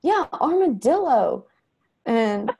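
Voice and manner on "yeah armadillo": put-on much higher and slow, the boy catching on; mother imitating her son, higher and slower; agreeable kid voice. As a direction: play the son happily repeating the word. Son repeating after mom.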